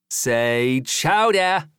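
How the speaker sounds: drawn-out, high New England "chowda"; New England accent. A narrator's reading. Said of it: "practicing his New England accent".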